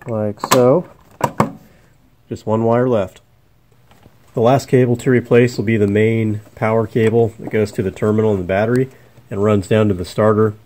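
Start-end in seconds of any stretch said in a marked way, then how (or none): none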